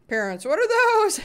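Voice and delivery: loud, high exclamation rising sharply; high-pitched burst of surprise; astonished. Real, not acted. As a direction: exclaim it in disbelief. Mock astonishment at the chat.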